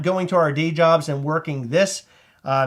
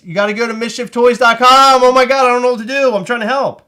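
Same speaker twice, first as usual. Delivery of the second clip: flustered; 6 semitones higher, 8 dB louder than usual. Real, not acted. Flustered helping deaf viewer.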